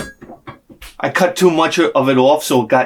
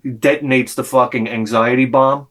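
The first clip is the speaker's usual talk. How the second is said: normal talking voice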